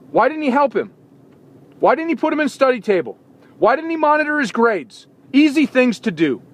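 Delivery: frustrated parent venting about a careless coach; exasperated rant. Real, not acted.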